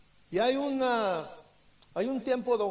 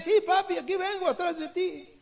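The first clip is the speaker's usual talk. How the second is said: put-on higher, louder little boy answering cheerfully; child calling back; 7 semitones higher, 3 dB louder than usual. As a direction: call out brightly from behind dad.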